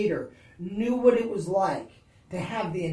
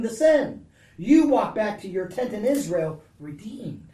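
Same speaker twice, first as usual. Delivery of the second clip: loud, higher, driving sermon voice; preaching at full force; 4 semitones higher, 6 dB louder than usual. Real, not acted. Preacher assuring the congregation that God says don't be afraid, at full force. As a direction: preach it out with rising fervor.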